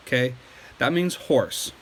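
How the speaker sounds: usual speaking voice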